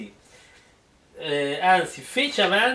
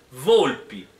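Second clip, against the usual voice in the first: ordinary talk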